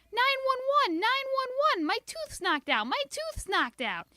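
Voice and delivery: high loud calls out the door; girl yelling out the front door; shouting for help. A narrator's reading. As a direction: holler nine one one.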